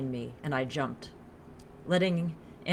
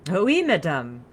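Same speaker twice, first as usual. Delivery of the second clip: loud, playful French "yes, madam"; mock submissive; 3 semitones higher, 9 dB louder, slower than usual. A narrator's reading. French woman answering her lover's order, mock submissive. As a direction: feign obedience with a playful flourish. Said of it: "pretended to be submissive".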